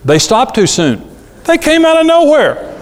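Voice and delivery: mock protest, shooting up very high; daughter making excuses. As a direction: protest your innocence in a high, overdone voice. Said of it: "Julie had five wrecks after she got her license".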